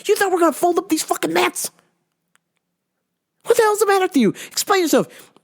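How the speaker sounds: fast, high, exasperated scolding; scolding dad for hiding the broken car; high-pitched rant. Retold or imitated. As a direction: tear into him at speed. Ranting at dad.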